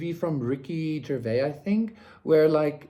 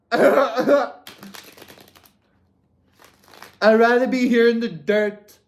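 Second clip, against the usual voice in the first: laughing through the words, louder; 7 semitones higher, 8 dB louder, slower than usual. Pretend. Reader laughing while voicing the querent's choice, louder. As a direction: chuckle through the line.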